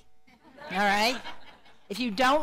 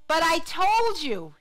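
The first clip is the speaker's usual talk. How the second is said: slow, loud, higher-pitched protest; insistent mock complaint, raised and drawn out; exasperated reminder; 5 semitones higher, 6 dB louder, slower than usual. Acting bosses' complaint to their people.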